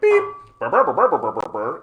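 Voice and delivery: high mechanical beep-burr noises; robot beeping. Retold or imitated. Streamer to chat, imitating Alexa with loud high beeps. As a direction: mimic a chirping smart speaker, loud then fading.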